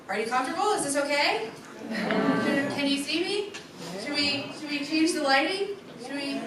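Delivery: ordinary talk, nothing marked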